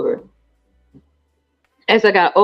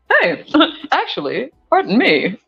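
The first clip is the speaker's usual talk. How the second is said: posh voice; 5 semitones higher than usual. Pretend. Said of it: "start talking like myself again".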